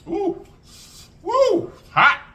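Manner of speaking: high yelps at the spicy heat; sudden high woo then loud hot; burned by hot sauce. Real, not acted. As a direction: squeal as the heat hits.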